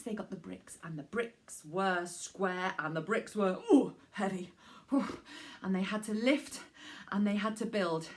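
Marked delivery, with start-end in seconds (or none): 3.7-5.2 straining groan. Acted. Lifting heavy bricks.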